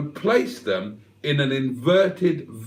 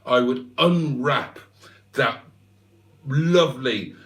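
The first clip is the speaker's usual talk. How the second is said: ordinary talk, nothing marked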